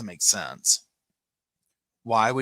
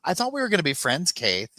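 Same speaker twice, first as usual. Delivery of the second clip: a higher, faster mock Lori whine; mimicking Lori; 8 semitones higher than usual. Lori teasing the interviewer, mock higher voice. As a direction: imitate her playful jab, pitched up. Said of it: "she's like".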